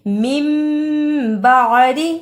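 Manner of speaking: मिन बादी with n turned into a long hummed m; iqlab. Shown as a demonstration. Teacher demonstrating the swap, मिन said as held "mim". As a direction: hum "mim" before बादी, slowly. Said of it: "नून साकिन की आवाज को मीम से".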